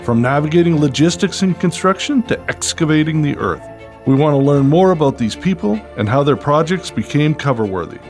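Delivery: upbeat announcer read over music; bright, punchy show-opening voice; podcast host intro. Acted.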